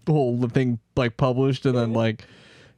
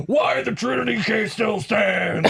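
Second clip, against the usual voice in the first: all-caps shout; 9 semitones higher than usual. Shown as a demonstration. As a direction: boom it out like a headline. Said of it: "'cause it's in all caps".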